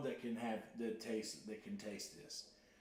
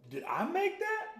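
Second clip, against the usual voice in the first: amazed disbelief; 4 dB louder than usual. Real, not acted. Amazed at his own sauce.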